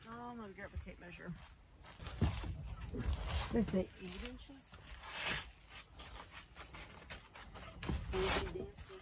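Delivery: faint and far off behind wall; muffled through a wall. Real, not acted. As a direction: call through the wall from outside. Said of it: "hear me through the wall".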